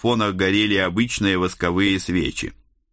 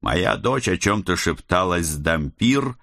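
usual speaking voice

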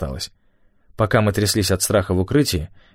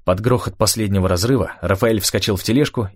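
usual speaking voice

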